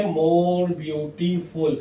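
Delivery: very slow, deliberate example; slow teacher dictation; dictating slowly. Shown as a demonstration. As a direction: let each word sink in for the class. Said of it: "teacher dictates comparative form".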